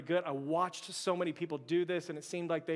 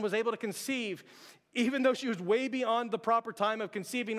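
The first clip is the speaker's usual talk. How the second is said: full-voiced, higher preaching cadence; 5 semitones higher, 3 dB louder than usual. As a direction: preach it to the congregation with conviction. Opening sermon reading, raised pitch.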